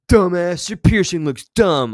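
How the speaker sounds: put-on loud, high, jeering kid taunt; mock schoolyard taunt. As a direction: sneer it like a playground bully. Mocking the bully's schoolyard taunt.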